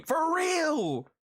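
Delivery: high-pitched shouted agreement; emphatic agreement. Real, not acted. Streamer agreeing that they are hating, emphatic shout. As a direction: holler it in wholehearted agreement.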